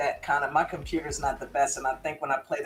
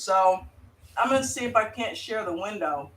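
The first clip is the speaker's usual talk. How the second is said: usual speaking voice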